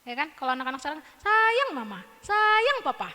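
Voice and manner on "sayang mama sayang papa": lightly voiced singsong cartoon child; Upin Ipin. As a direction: chant it like a little kid. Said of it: "Kayak Upin Ipin ya". (suara anak kartun yang riang)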